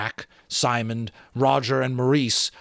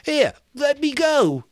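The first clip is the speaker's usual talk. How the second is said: much higher, slow, frightened wail; Piggy shrieking as the boys surround him; terrified shriek; 6 dB louder, slower than usual. A narrator's reading. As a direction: shriek as they grab him. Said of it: "His voice rose to a shriek of terror".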